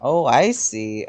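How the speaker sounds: ordinary delivery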